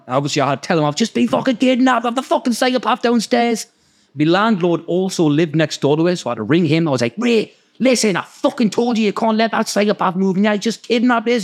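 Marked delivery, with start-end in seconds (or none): 0.9-3.7 high-pitched acted-out distress; mock panic, pitched up; retold frantic report. Frantically telling flatmate of kidnap. 7.2-11.4 higher-pitched urgent pleading; acted-out distressed protest; retold frantic complaint. Frantic phone complaint to landlord.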